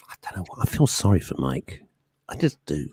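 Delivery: drops to a whispered aside. Real, not acted. Host whispers a pitying aside about Mike. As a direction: whisper it like a secret.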